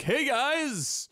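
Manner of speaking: high, chirpy fake-excited pitch; mock cheery developer. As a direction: sell it like a cheesy hype announcement. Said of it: "were like".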